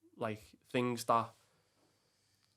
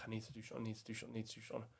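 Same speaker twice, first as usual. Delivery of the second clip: muttered to himself; 12 dB quieter, much faster than usual. Retold or imitated. Panicked alone, repeating himself.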